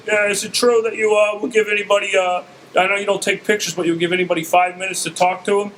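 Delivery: high-pitched impression of a sitcom comic; Ray Romano. Friend asks star for five minutes, Ray Romano voice. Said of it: "my friend sounds like Ray Romano".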